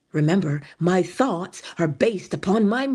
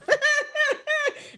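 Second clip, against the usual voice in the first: high burst of laughter. Real, not acted. Laughing at Barbados phrase.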